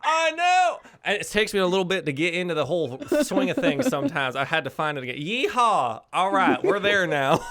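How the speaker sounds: high, loud southern twang; excited, hammed-up southern accent. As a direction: belt it out in a big southern accent. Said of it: "can't remember how to speak in a southern accent".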